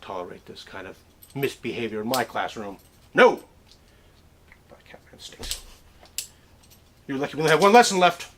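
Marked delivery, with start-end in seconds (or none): 4.7-5.6 taunting unvoiced whisper. Student mocking the professor under their breath, whispered. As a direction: whisper it behind the teacher's back.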